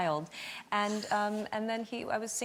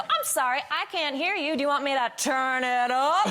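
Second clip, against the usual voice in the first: mock cupped-ear tease; 6 semitones higher, 5 dB louder than usual. Teasing Craig with mock outrage.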